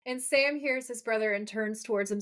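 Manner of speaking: ordinary talk, nothing marked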